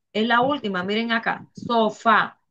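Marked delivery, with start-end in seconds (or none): 1.5-2.4 "Sofá" in two parts, landing on FÁ; teacher's demonstration of "Sofá", final syllable stressed; stress on last syllable. Shown as a demonstration. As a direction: separate "Sofá" as so-FÁ to show the stress. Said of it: "La última sílaba es la fa".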